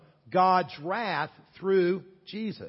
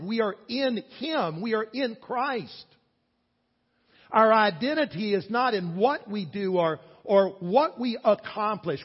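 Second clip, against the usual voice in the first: higher, louder preaching build; rising preaching; 6 semitones higher, 5 dB louder than usual. Acted. Pastor assuring the church of identity in Christ, preaching. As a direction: lift the room with each claim.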